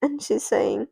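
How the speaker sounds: usual speaking voice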